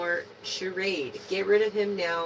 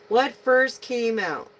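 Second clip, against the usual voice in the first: higher, louder and slower, stress on "first"; louder repeat; 5 semitones higher, 5 dB louder, slower than usual. Shown as a demonstration. Reader asking herself which card came first, louder. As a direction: ask "What first came out?" again, firmer.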